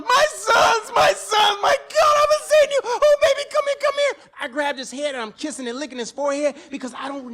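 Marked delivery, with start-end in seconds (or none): 0.0-4.3 high joyful shouting reunion cry; hollering. Retold or imitated. Father shouting to his sons at the reunion, overjoyed. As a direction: holler it out, overjoyed to see your boys. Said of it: "I just start hollering".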